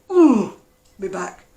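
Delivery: loud, slow drawn-out "Ooh, my back"; pained ooh. Pretend. To viewers, showing her own rare real complaint of pain. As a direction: let the pain out in one long groan. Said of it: "if you hear me say".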